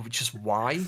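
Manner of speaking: ordinary talk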